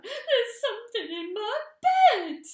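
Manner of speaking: high, loud frantic voice; panicked shriek. Acted. Missus Twit panicking in bed. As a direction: shriek in terror. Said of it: "bouncing about".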